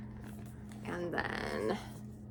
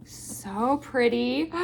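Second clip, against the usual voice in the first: ordinary talk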